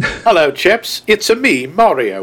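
mock British-Italian accent; British greeting sliding into cartoon Italian; Mario. Co-host joking about movie accents, British turning Italian accent. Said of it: "Let's hear an Italian accent".

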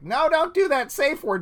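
high, loud mock-frantic plea; alarmed plea. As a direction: imitate someone urgently begging them to stop. Acted plea in retold scene.

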